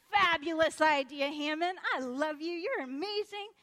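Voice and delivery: loud, high, effusive king; delighted praise. Acted. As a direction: gush over a brilliant idea. King delighted with Haman's idea.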